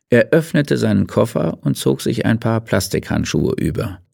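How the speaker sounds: ordinary delivery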